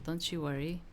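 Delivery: normal talking voice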